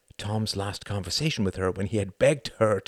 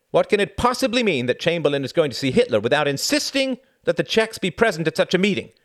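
loud, higher, indignant demand; a president loudly demanding answers; 9 semitones higher, 6 dB louder than usual. Acted. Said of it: "he demanded".

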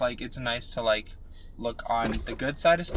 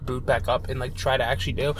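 usual speaking voice

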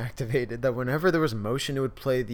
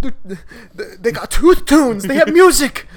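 horrified TV lady; 10 semitones higher, 13 dB louder than usual. Retold or imitated. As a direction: ham up a shocked ad lady. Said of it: "She's like".

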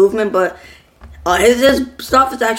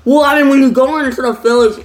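words around a lollipop; talking with a lollipop; 5 semitones higher than usual. Real, not acted. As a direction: talk with a sucker in mouth. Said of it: "Sucker out of mouth".

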